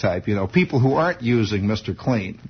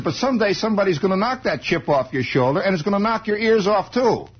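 tough guy warning; 9 semitones higher than usual. Retold or imitated. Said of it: "He says".

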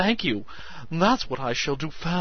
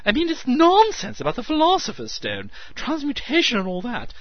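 excited; 10 semitones higher, 9 dB louder than usual. A narrator's reading. Meadows excitedly lecturing.

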